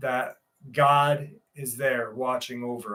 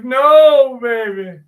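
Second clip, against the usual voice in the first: mock protest; 9 semitones higher, 10 dB louder, slower than usual. Joking about refusing the hag, loud mock yelp. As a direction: yelp the no, then ease off. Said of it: "I'll be like".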